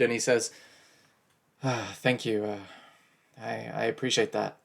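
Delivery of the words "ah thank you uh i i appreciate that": subdued, forlorn. Acted. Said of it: "forlorn and sort of subdued".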